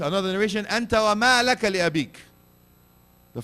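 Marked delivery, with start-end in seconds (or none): 0.6-2.2 loud, quicker repeated proclamation; forceful declaration. Acted. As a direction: repeat it fast and strong. Second narration repeated.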